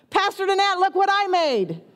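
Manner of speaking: a lightly voiced excited eighth grader; proud kid. Boy shows the pastor his huge cookie, eager and proud.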